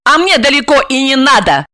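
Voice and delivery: high, curt comeback; cutting retort. A narrator's reading. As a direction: cut him off, pitched high. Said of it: "отрезала Ася". (отрезая, высоким голосом)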